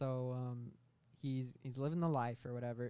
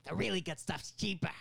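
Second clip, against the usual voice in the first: loud, raised-pitch dealer impression; Willem Dafoe; 5 semitones higher, 5 dB louder than usual. Meth dealer upselling his customer, hammed-up Willem Dafoe. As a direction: make a theatrical sales pitch. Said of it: "Willem Dafoe says".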